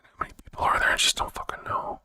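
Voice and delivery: hushed, breathy mutter under his breath; muttered aside. Real, not acted. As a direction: mumble it to yourself, barely voiced. Muttering to himself off-mic.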